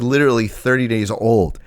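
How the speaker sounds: ordinary talk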